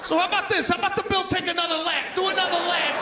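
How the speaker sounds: high, loud sarcastic push; sarcastic demand. Retold or imitated. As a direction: send the bill round again, mocking. Ranting at the table.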